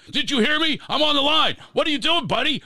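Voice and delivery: high, loud angry yell down the phone; retold yelling. Exec on the line yelling at the kid who won't hang up. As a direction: bark it like an annoyed exec on the line. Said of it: "He's screaming".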